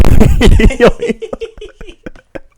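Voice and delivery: high, loud cackle of laughter; helpless laugh at the embarrassing story; high shrieking laugh. Real, not acted. Laughs at his own story.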